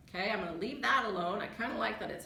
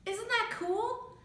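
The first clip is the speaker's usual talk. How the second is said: excited and drawn out; 11 semitones higher, slower than usual. Real, not acted. Delighted by read-aloud feature.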